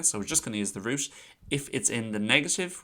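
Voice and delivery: ordinary talk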